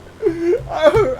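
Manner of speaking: high laughing half-sob; hammed-up weepy laugh; teary laugh. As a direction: laugh through mock tears. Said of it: "I'm getting all emotional here".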